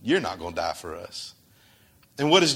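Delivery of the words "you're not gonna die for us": low, soft voice for Peter's protest; Peter objecting. Retold or imitated. Peter stops Jesus talking of death, low and soft. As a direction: Peter hushing Jesus, dropping to a low murmur. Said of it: "Peter steps up and he goes".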